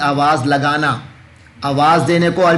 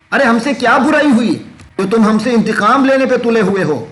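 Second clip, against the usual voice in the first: indignant protest; 7 semitones higher, faster than usual. Acted. Believers protesting to People of Book.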